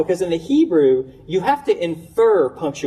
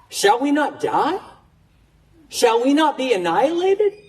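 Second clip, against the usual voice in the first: rising, higher, anguished questioning of God; a prophet arguing with God, voice climbing in alarm; pleading prophet; 5 semitones higher than usual. Acted. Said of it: "And Habakkuk is saying".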